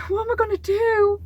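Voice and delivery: high, loud, despairing cry; desperate. Retold or imitated. Before payday with no money, a high, loud despairing cry. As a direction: throw the question up in despair. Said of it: "And I'm like".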